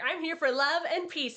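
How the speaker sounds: cheery hero line. Retold or imitated. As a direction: announce it brightly. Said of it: "he's like".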